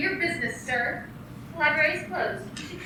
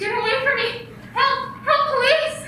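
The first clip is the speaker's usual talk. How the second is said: high, loud panicked cries for help; terrified woman shouting for the police; screaming for help; 8 semitones higher, 8 dB louder than usual. Acted.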